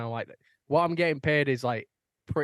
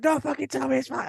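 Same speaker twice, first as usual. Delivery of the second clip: angry retort; 13 semitones higher, 3 dB louder than usual. Retold or imitated. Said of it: "It's like".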